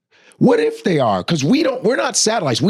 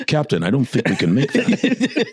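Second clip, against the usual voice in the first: lightly voiced, lower, worried officer; a hint of a grave starship officer; Star Trek officer; 4 semitones lower than usual. Comic imitating Star Trek crew warning captain, mock grave. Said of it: "He's like this".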